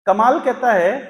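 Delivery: ordinary delivery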